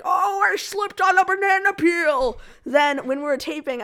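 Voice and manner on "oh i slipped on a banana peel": loud, high, drawn-out goofy voice; slow, hammed-up wail of a line; silly voice reading. Shown as a demonstration. Actor demos a line said wrong, silly drawn-out voice. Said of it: "If I said it like".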